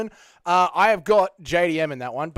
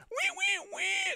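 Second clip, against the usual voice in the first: tiny high-pitched wee wee squeal; 7 dB quieter, slower than usual. Pretend. Streamer squeals at his chat in a tiny high voice. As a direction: squeal like a little piggy.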